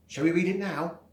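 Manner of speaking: plain reading voice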